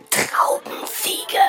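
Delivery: slow whisper, syllable by syllable. Acted. Player passing the word to Conny, slow whisper. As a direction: whisper the word carefully into an ear. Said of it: "flüstert dem Nächsten ein Wort oder einen Satz ins Ohr".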